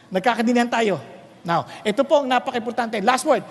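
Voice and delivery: ordinary talk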